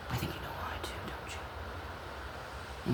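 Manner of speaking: soft, voiceless, threatening hush; hushed warning to the accused coworkers; menacing whisper. Real, not acted.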